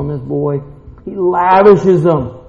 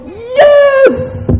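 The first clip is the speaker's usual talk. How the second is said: very high, jubilant cry; long celebratory squeal; God rejoicing; 4 dB louder than usual. Acted. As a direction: burst out in triumph. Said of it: "God goes".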